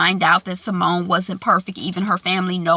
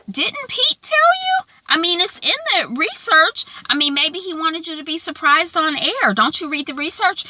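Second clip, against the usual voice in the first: fake-innocent Kara; 9 semitones higher than usual. Kara coyly blaming Pete to the angry anchor, much higher. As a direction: feign innocence in a sing-song.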